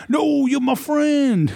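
high, slow, drawn-out wail; mock high-pitched pleading; Fred. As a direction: mimic a clingy pest, stretched and high. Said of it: "it's usually Fred".

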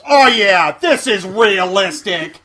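loud sarcastic yell; screaming. Retold or imitated. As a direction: shout it across the theater. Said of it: "he stands up and screams".

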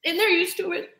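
tearful and grateful, fighting to hold it together; emotional, near tears. Real, not acted. Guest to the host, tearfully thankful for the miracle. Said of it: "I don't know why I'm so emotional today".

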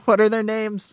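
high, laughing as he asks; laughing question. Real, not acted. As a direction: laugh through the question, names escaping you. Laughs while forgetting names.

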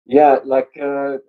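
ordinary delivery

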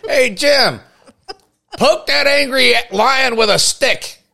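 hammed-up, high-pitched shouted orders; Marlon Perkins. TV host atop the Land Cruiser to Jim by the lion, mock urgent shouting. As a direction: holler to the man in danger from a safe perch. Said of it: "Marlon Perkins. ... sitting safe and sound".

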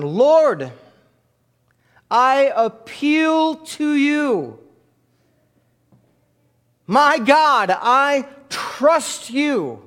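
slow, high, loud prayerful reading of the verse; solemn prayer reading. Acted. Pastor reading a psalm aloud to the church, slow and soaring. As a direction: lift the words up slowly like a cry to God.

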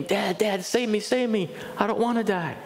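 imitating his son's plea, not full volume; retold child's cry.

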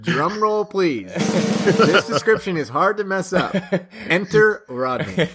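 high, slow mock announcer voice; playful announcer send-up with a laugh; mock announcer intro. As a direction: tease with a drawn-out, high-pitched introduction. Teasing mock introduction of co-host.

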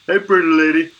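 high, loud giant voice; booming friendly giant; Andre the Giant impression. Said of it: "the Andre the Giant".

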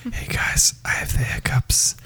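breathy ASMR whisper. Pretend. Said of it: "A S M R [name]".

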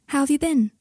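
high-pitched character voice for Luna; voiced Luna. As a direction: lift the voice high for her line. Luna voiced in narrated story.

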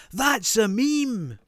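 high, slow, delighted shout; excited kid shout. Retold or imitated. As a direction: yell it like an excited child. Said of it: "he suddenly pointed out".

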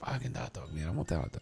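hushed, longing mutter; whispered craving. Retold or imitated. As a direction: whisper the craving under your breath. Craving hot dog, muttering.

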